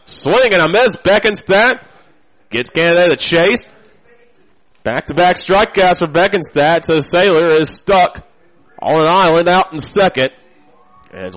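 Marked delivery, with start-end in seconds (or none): 0.1-9.7 excited call. Real, not acted. Strikeout call on payoff pitch.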